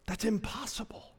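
a hushed, certain aside; whisper. Acted. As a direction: whisper it like a secret. Aside after regret line.

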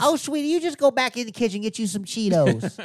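high, doting Southern granny, laughing; grandma sending the kid for Cheetos; country grandma. Pretend. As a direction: fuss over him like a sweet granny. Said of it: "Meemaw was like".

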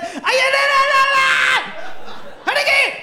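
squealing mock baby preacher; Obi. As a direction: imitate a two-year-old shouting along with the sermon. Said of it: "then Obi will be like".

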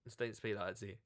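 ordinary talk

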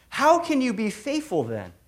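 ordinary talk, nothing marked

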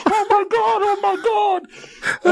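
high drawn-out mock moan; mock moaning woman. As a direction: drag it out high like the woman in the clip. Woman in video voiced.

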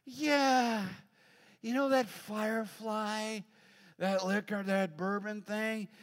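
high, slow, drawn-out daughter voice; tipsy daughter. Retold or imitated. Daughter tells her dad about the bourbon, tipsy and slow. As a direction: drag the words like a tipsy girl. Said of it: "She's like".

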